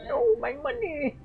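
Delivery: high whiny cry; mock whiny wail. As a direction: wail over lost money like a cartoon. Repeats the bit, praised after.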